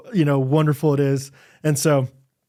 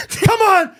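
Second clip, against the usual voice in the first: high, strained urging; will her to hurry; urgent plea; 4 dB louder than usual. Retold or imitated. Husband urging the midwife on, high and strained. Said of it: "urging while midwife arrives".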